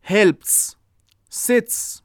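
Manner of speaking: "helps", "sits", each alone with a crisp unvoiced s ending; final voiceless s. Shown as a demonstration. Teacher gives examples of the s ending, voiceless hiss. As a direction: end "helps" and "sits" on a hissed s.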